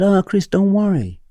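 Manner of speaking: put-on high-pitched Hong Kong-accented reassurance. Head of English to late teacher, Hong Kong accent. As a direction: imitate a Hong Kong woman reassuring someone. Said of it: "If I do the accent".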